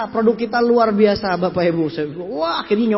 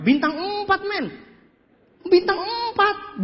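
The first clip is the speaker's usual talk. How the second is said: high, drawn-out "empat"; mock boasting; 9 semitones higher, slower than usual. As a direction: show off, stretching EMPAT up high. Boasts of four-star meeting venue.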